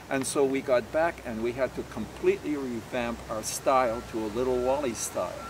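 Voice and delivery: ordinary talk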